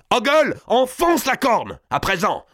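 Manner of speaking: high, forceful command; urgent order. A narrator's reading. Gournay commands his accomplice at the murder, urgent order. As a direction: shout it slowly over the struggle. Killer orders the deadly act.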